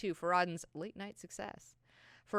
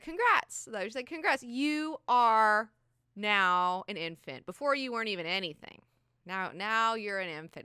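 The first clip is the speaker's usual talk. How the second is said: mock congrats; 4 semitones higher, 8 dB louder than usual. Jessica's dry congratulation.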